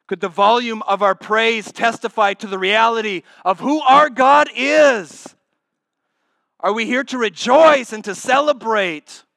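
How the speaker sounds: loud and high, slowing on who our God is; fiery pulpit appeal; full-force preaching. Acted. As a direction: rouse the congregation.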